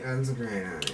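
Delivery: normal talking voice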